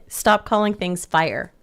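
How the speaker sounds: ordinary talk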